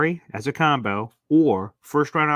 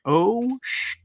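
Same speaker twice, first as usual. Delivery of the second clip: panicked surprise; 12 semitones higher than usual. Retold or imitated. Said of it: "And I'm like".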